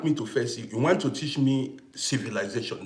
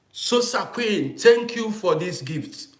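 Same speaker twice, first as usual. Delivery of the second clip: higher, slow, drawn-out delighted thanks; excited thanks; 7 semitones higher, 5 dB louder, slower than usual. Real, not acted. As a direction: stretch out the name in delight. Thanking a viewer for gift.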